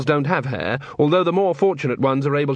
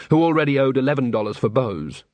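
ordinary delivery, nothing marked

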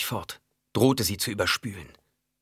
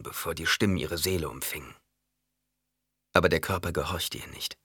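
ordinary delivery

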